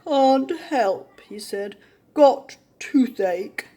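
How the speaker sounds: slow, moaning crocodile with toothache. A narrator's reading. Crocodile moans he can't help, slow groan. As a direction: groan it out, sorry for yourself. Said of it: "just moaned".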